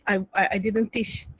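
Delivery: embarrassed laugh through words; laughing sheepishly. Real, not acted. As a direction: laugh it off as you admit it. Admits skipped syllabus, laughs.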